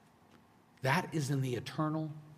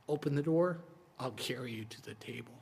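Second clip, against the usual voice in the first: tender Jesus; 5 dB quieter than usual. Acted. Jesus speaks tender invitation.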